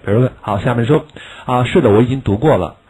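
ordinary talk, nothing marked

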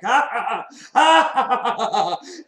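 high, loud maniacal cackle; John Candy devil laugh. Acted. Devil mocking his passenger on the doomed bus, maniacal laugh. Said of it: "like, laughing".

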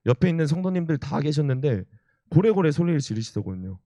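ordinary talk, nothing marked

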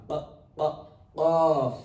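short isolated "Q" bursts; qa sound. Shown as a demonstration. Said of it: "The qa sound".

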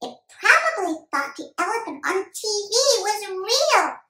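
put-on high, loud kid voice; boy reasoning out a clue; pondering young boy.

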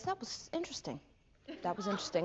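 soft, understated verdict; imitating an actor. Actor dusting himself off after dying, quiet deadpan. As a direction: say it quietly, as if musing. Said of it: "dusts himself off and goes".

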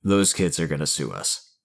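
dismayed agent. Acted. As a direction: say it like a beaten boss.

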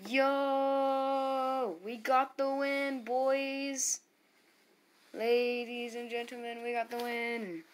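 high, drawn-out victory cry; elated, sustained high-pitched announcing; triumphant cheer. Real, not acted. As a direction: stretch out each shout in celebration. Celebrating winning the battle.

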